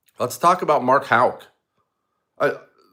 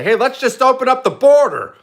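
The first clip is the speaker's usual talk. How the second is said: much higher, louder, cartoonish mock of leftists; 14 semitones higher, 7 dB louder than usual. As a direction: sneer it like a silly caricature of the other side. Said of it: "they walk in and they say".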